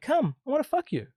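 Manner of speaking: hammed-up seductive invitation; acted lustful invitation. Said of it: "it's how you create an invitation".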